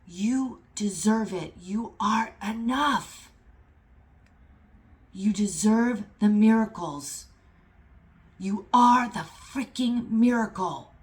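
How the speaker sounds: fired-up motivational exhortation; impassioned roar. Real, not acted. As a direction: roar it from the chest, word by word. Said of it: "I can feel this roar in my chest".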